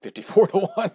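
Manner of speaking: can't believe the figure, laughing; a real burst of laughing disbelief; amazed laugh. Real, not acted. Astonished at a huge number.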